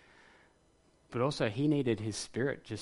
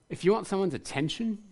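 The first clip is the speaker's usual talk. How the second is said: pleading question; 8 semitones higher than usual. Pretend. Preacher voicing his plea to God.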